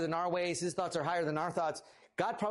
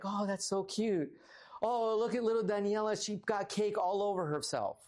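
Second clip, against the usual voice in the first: put-on cooing at a baby; 4 semitones higher than usual. Adults cooing at little Daniela covered in cake, sweet baby talk. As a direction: coo over the messy toddler lovingly.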